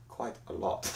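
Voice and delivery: low, very soft mock-ominous reply; quietly imitating a grave answer; hushed retold answer. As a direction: drop right down, nearly under the breath. Same answer repeated, hushed.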